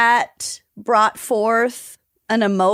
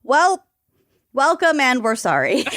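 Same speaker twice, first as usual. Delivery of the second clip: ordinary delivery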